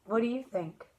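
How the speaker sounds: ordinary delivery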